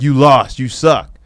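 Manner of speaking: acted blunt put-down, pushed loud; mock jeering. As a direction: jeer like an online hater. Voices social media jeering.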